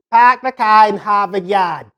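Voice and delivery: mock Boston accent, R's dropped; exaggerated non-rhotic Boston accent. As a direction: imitate a Bostonian's broad, flattened A's. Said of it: "they got this really weird way of talking too".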